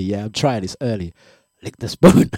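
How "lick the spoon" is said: louder, higher sly taunt; the ex's voice, held this time. Acted. Host redoes the ex's spoon line, louder mock sly taunt. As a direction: lean into the line and keep it together. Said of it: "just work with me".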